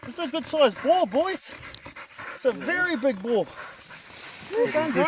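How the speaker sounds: loud and high, thrilled with the catch; high, loud hunting cheer; excited. Real, not acted. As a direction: crow over the big boar, pumped. Proud hunter over a big boar.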